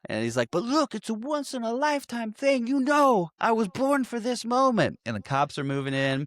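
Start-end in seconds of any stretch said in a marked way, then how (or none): 0.5-5.0 high-pitched, hammed-up movie plea; imitated pleading. Surfer criminal begging the agent to release him, mock pleading. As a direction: plead theatrically, pitched well above your voice.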